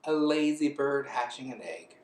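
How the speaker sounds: plain reading voice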